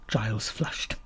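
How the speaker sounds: plain reading voice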